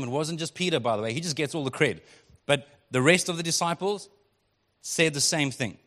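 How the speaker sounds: normal talking voice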